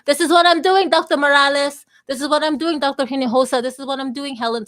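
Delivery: excited shout-outs to former doctors; calling out. Real, not acted.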